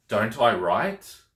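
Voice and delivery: slow question with "write" rising at the end; questioning intonation. Shown as a demonstration. Teacher turning a negative statement into a question, questioning intonation. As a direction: draw it out and lift "write". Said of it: "it becomes a question".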